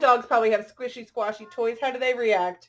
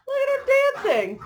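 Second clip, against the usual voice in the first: high and quick, delighted pointing; excited; 12 semitones higher, 6 dB louder than usual. Real, not acted. Showing the kids the dancing dog picture, delighted and higher.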